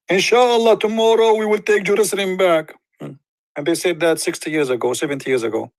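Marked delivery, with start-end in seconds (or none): 0.1-2.7 mocking quote. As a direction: ham up the hollow promise.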